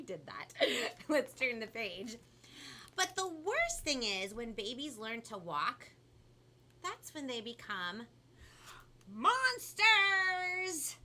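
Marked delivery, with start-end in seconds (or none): 3.0-11.0 animated storybook build to a loud, high reveal; dramatic storytelling for children; picture book read-aloud. A narrator's reading. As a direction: build suspense, pause, then belt out the scary word.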